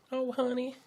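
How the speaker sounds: high, sweet-talking Southern belle; Southern belle accent. Acted. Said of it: "I love that Southern Belle accent".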